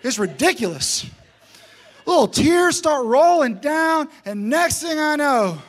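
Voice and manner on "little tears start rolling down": mock-dramatic, higher and louder. Coach mocking his crying players, hammed-up. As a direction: ham up the tears. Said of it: "I'm making fun of 'em".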